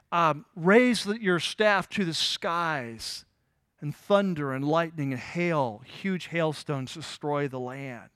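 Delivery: slow, weighty divine order; slow solemn command. Acted. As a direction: draw out each word like an awesome command. God orders the hail plague.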